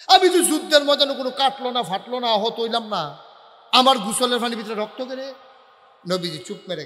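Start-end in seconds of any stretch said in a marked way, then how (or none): 0.1-5.3 loud, agitated disbelief; startled questioning. Acted. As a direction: burst out in baffled protest. Acts startled Prophet questioning the blood. (চমকে উঠে জোরে প্রশ্ন)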